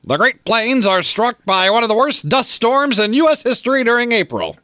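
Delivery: put-on high, bright old-time newsreel voice; old radio announcer. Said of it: "like a nineteen thirties radio announcer".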